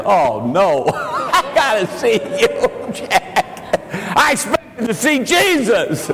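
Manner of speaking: high-pitched comic wail of disappointment; mock dismay. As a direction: imitate a let-down wife, hamming it up through laughter. Acts out wife's comic dismay.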